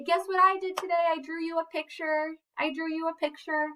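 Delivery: high, excited child voice, lightly played; excited little girl. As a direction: squeal it like a kid showing her dad a drawing. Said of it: "She gets to say".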